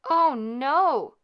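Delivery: high, loud cry of horror; cry of dismay. Acted. Lords and ladies crying out at the curse, high and loud. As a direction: gasp it out loud in shock. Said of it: "cried the lords and ladies".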